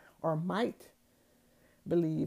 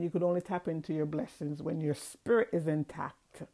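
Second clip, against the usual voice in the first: ordinary talk, nothing marked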